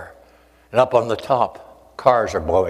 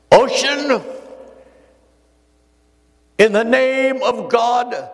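commanding the ocean; 12 semitones higher, slower than usual. Retold or imitated. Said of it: "he raised his hands and he said".

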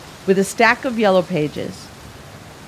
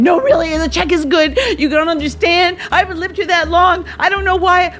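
weeping and begging; 9 semitones higher than usual. Retold or imitated. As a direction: plead through tears that the check is good. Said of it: "so I start weeping and begging again".

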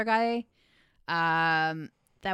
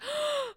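big sharp high gasp of delight; mock excited gasp. Guys at the wine tasting, gasping in mock delight. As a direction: gasp like it's thrilling news. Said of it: "they're all like".

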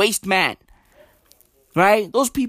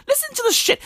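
much higher disbelieving call; incredulous; much faster than usual. Real, not acted. Vlogger introducing the minister's clip, incredulous. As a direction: squeak it out in disbelief.

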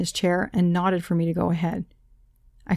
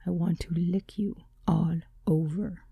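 seductive whisper; 5 dB quieter than usual. A narrator's reading. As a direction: breathe it like a secret, seductive. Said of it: "His deep and seductive voice in my ear".

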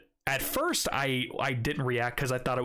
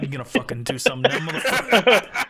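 louder, laughing tough-guy challenge; mock tough threat; 9 dB louder than usual. To the owner who grabbed him, a laughing mock threat. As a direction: bark it like a playful dare.

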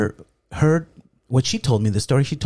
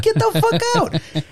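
shrieked high in disbelief; stunned outburst. Retold or imitated. Dad yelps high at daughter's news, stunned disbelief. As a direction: squeal it out, floored by the news.

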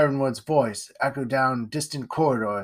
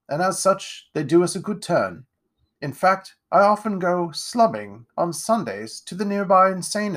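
higher, grand aristocrat voice; pompous lord; 5 semitones higher, 3 dB louder than usual. Acted. Lord Ironwood greeting Jack, pompous and grand. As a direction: puff yourself up like a self-satisfied lord. Lord condescends about the poor.

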